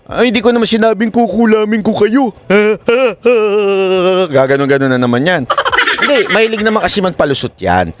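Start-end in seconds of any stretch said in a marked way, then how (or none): 0.1-2.3 high mocking whine of her excuse; mocking imitation. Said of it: "sasabihin na naman niyang palusot". 2.4-4.3 high taunting cackle; mock laugh. Mocking the excuse.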